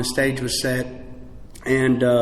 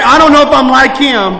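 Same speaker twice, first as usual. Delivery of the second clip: loud, high-pitched mock protest; addict's loud doubt; 9 semitones higher, 11 dB louder than usual. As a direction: play the doubtful addict at full volume. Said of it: "You know what the addict said".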